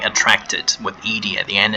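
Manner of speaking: normal talking voice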